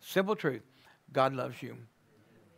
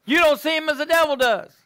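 higher, louder, You don't pressed hard; emphatic repeat; 10 semitones higher, 9 dB louder than usual. Shown as a demonstration. Preacher answering the devil, emphatic louder repeat. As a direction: punch the repeat with force. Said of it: "repeated with more force".

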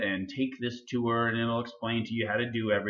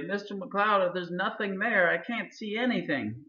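much higher-pitched imitation of a helpless student; high mock-student voice; complaining student; 9 semitones higher than usual. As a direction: mimic a student calling the teacher for help. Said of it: "often students will say".

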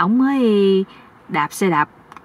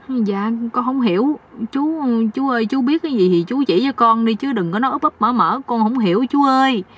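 higher-pitched, pleading naive voice; confused young husband; 4 semitones higher than usual. Acted. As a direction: beg the older man to explain. (giọng chàng trai ngơ ngác, năn nỉ)

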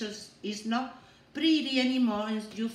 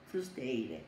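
quiet naming; 9 semitones lower, 6 dB quieter than usual. Shown as a demonstration. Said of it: "And what you say".

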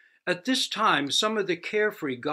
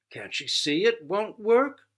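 higher, louder scoffing exclaim; 7 semitones higher, 6 dB louder than usual. Acted. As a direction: exclaim in disbelief. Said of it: "exclaimed the first god".

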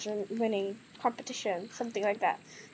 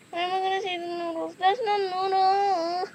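mock wail; 7 semitones higher than usual. Vlogger fretting that the noodles are hidden, mock wailing. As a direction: wail it like a hammed-up tantrum.

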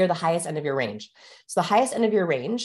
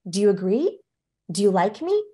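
questioning lift at each end; approval-seeking uptalk; 3 semitones higher than usual. Shown as a demonstration. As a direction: plead lightly to be liked. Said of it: "because they're asking".

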